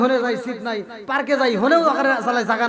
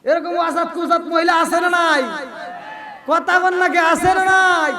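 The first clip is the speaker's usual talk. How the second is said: calling out; 6 semitones higher, 5 dB louder, slower than usual. Real, not acted. Preacher challenging crowd loudly.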